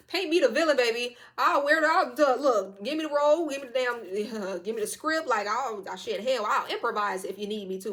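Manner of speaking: hyped-up, defiant bravado; bold villain volunteer. Acted. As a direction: claim the part loudly, with relish. Eagerly claiming the villain role.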